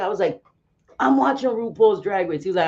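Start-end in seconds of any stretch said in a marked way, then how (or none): 1.0-2.4 higher-pitched, mildly indignant comeback; retold indignant reply. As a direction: act out telling your son what's on. Retelling her answer to son.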